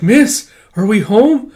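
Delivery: high, slow character voice, rising at the end; childlike questioning. Retold or imitated. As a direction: pitch it up for an anxious youngster. Voicing young Kippa's question.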